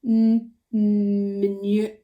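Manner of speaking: slow, stretch the m in "manure"; sounding out. Shown as a demonstration. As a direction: hold the m before "manure". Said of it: "see if it begins with it".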